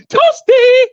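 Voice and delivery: high mocking sing-song; mock taunt. Taunting a rival YouTuber, high mock call.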